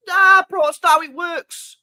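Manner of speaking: high, loud exasperated shout; real shout at moaning players; heated shout. Real, not acted. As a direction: shout it down at them, fed up. Ranting at greedy players.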